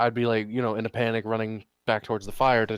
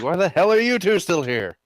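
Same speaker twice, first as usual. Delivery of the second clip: loud, higher-pitched acted rebuke; indignant challenge; 7 semitones higher, 5 dB louder than usual. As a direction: demand an answer from slacking guards. Confronts guards who stayed behind.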